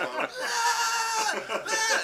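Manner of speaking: high laughing yelp of alarm; mock panic. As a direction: squeal like a panicked coach, laughing. Coaches panicking on the sideline.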